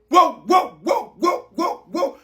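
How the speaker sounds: rapid string of repeated yelps; yelping wows. Acted. Fan maddened by coach's choices, repeated yelps. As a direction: yelp it over and over like someone gone mad. Driven mad by the squad list.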